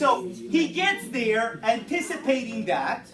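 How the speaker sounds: loud and drawn out over chatter; slow down and project to the class. Real, not acted. Teacher regaining a chattering class, loud and slow.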